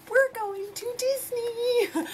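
very high, drawn-out excited squeal; giddy high-pitched cheer; gleeful announcement. Real, not acted. Announcing the Disney trip with glee.

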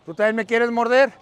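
ordinary talk, nothing marked

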